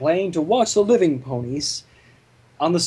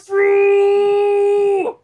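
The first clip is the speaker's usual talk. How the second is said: booming Big Dash cry; 7 dB louder than usual. Acted. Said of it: "weirdly deep, booming voice of Big Dash".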